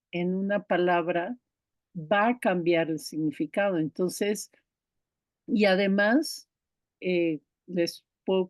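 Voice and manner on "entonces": ordinary talk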